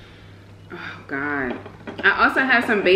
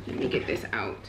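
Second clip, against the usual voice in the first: whispering; 10 semitones lower, 11 dB quieter than usual. Real, not acted. Aside while unpacking food.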